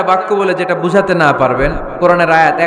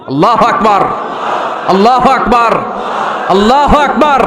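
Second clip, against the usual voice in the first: high, loud slogan cries, building; shouted takbir; 5 semitones higher, 5 dB louder than usual. Retold or imitated. Marchers raising the takbir in Mecca, re-enacted rallying shout. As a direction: shout it like leading a march, each cry higher. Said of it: "আল্লাহর নামের তাকবীর জুড়ে দিলেন".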